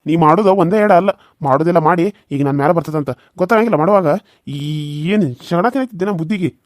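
loud, furious telling-off; angry scolding. Acted. Husband scolds his wife for the beating, loud and furious. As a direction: rage at her for what she did.

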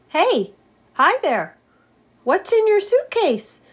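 high, loud curious friend; eager high character voice. Acted. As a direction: call out eagerly to the newcomer.

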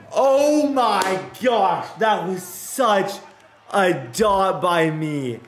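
high, slow, drawn-out amazement; amazed shout. Real, not acted. Gamer marveling at his own throw, amazed shout. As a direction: savor the throw out loud.

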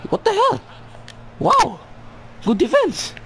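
real shocked gamer reaction; surprised outburst. Real, not acted. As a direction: pitch jumps far up in disbelief. Shocked by a play.

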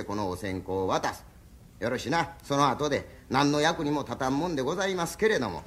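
ordinary talk, nothing marked

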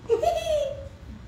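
high-pitched impish giggle; put-on high titter of a sneaking devil; sneaky giggle. Preacher imitating Satan sneaking in, high sneaky giggle.

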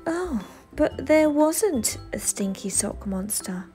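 usual speaking voice

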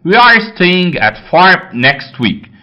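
very slow, each word laid out; slow dictation. Shown as a demonstration. Teacher reading the next exercise sentence slowly to students.

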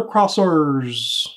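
CROSSERS drawn out with a very hard R; "crossers" with an overdone, growing R sound. Acted. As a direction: lean slowly on the R in "crossers". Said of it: "That's a pretty hard R you use there".

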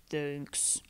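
low, soft gentle bear; a big shy bear mumbling thanks. Acted. Bear thanking Mouse for the pie, low and soft. Bear's voice.

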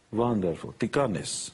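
lightly imitated Hungarian accent, V for the W; a touch of his mother's accent; mother's lightly accented reply to the check. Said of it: "she's Hungarian".

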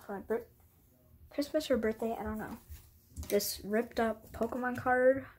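normal talking voice

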